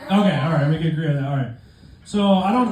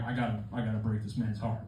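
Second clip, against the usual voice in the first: hushed dread; 6 semitones lower, 11 dB quieter than usual. Retold or imitated. Dreading telling dad.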